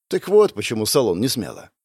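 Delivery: ordinary delivery, nothing marked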